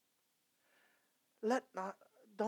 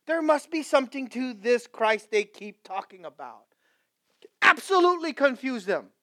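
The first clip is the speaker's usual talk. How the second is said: full-force preaching; 7 semitones higher, 15 dB louder than usual. Acted. Pastor crying out to his congregation, loud and high. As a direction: preach it to the rafters.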